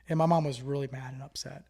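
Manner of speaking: ordinary talk, nothing marked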